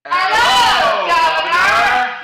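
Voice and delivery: very loud, drawn-out Cockney bellow; Cockney attempt. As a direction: blast out a hammed-up Cockney greeting. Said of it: "Let's try the Cockney then".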